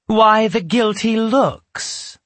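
slow, triumphant witch. Acted. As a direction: savor the catch, slowly. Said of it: "the witch asked".

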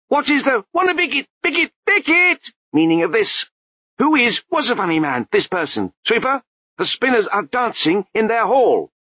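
a high babbling abbot voice, breaking into nonsense; abbot's voice. A narrator's reading. The abbot scolds the crowd, high babbling voice.

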